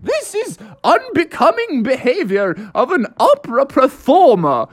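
high, swooping, fluty indignation; hammed-up haughty opera voice; outraged opera singer. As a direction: scold like an offended opera diva. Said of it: "everybody was like".

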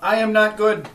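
loud, high robotic pronouncement. Acted. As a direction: deliver it like a stiff machine boss. Robo answers Sparrow.

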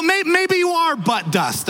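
ordinary talk, nothing marked

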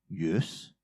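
curt, low grudging yes; a clipped, sullen yes after a fight; sulky yes. Retold or imitated. Spouse after a fight, mock sulky yes. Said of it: "And you're like".

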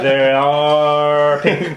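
drawn out and very slow; slow radio report. Pretend. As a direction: stretch every word like a radio report.

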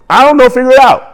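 high-pitched mock brush-off; comic brush-off. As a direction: toss it off high, as a joke. Joking answer to forgetting something.

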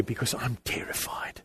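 put-on a whisper of a scared boy; whispered fear. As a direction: breathe it out in fear. Frightened child in the dark.

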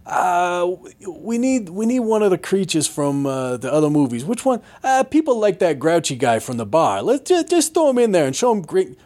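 mock brainstorming, pitched high; high, hammed-up committee member voice; imagined movie pitch. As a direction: act out someone tossing out a lazy idea. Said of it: "someone in a moment was saying".

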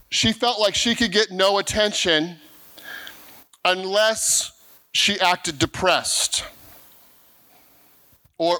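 ordinary talk, nothing marked

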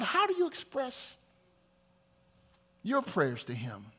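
ordinary talk

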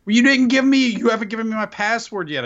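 high, indignant complaint to the host; high-pitched protest. Real, not acted. Co-host blaming host for the missing password, high-pitched protest. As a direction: jump up high, starting loud.

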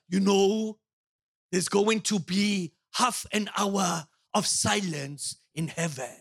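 put-on slow, low German accent; imitating a measured German preacher; put-on German accent, drawn out and deliberate. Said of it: "You like my German accent?".

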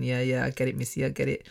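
soft mock agreement.

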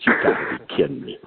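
low, fast, muttered in disbelief; stunned disbelief. Retold or imitated. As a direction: say it low under your breath, floored. Stunned by the same fish.

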